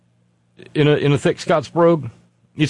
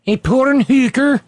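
"A porn hooker?" pitched higher and louder in a hammed-up Eastern European accent; mock Balkan accent; 10 semitones higher, 4 dB louder than usual. Host trying Melania's accent again, higher mock Balkan accent. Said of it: "I've been saying porn hooker".

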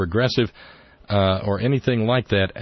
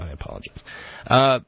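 normal talking voice

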